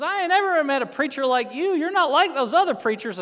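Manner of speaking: amazed folksy driver; high hearty good ol' boy; tow truck driver Bubba. Retold or imitated. Bubba reacts to the pastor.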